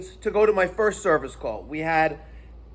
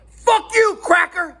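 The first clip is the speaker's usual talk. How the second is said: higher, louder mock yell of the stranger; angry retold shout; 10 semitones higher, 4 dB louder, slower than usual. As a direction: bark it back like the guy on the road did. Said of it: "And his response is".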